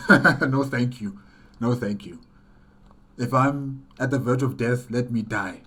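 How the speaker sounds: ordinary delivery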